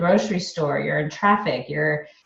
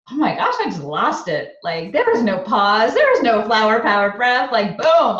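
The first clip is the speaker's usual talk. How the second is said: louder, emphatic blow-by-blow of an outburst; animated retelling; 4 semitones higher, 4 dB louder than usual. Retold or imitated. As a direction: build to a punchy boom. Acting out losing her temper.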